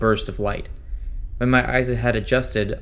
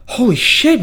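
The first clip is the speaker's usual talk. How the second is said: shocked exclamation; 14 semitones higher, 8 dB louder than usual. Acted. Kev shocked seeing Mac.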